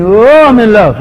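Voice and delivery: loud, high lovestruck cry; mock infatuation. Preacher mimicking someone who mistakes heat for love, mock infatuation. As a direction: gush it out, imitating someone smitten.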